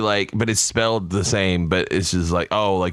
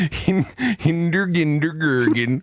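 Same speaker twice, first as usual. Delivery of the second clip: put-on high-pitched silly Scandinavian accent; mock Scandinavian; 8 semitones higher than usual. Host mocks how the word sounds, cartoon Scandinavian accent. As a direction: gibberish in a hammed-up Scandinavian lilt. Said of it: "it sounds Scandinavian".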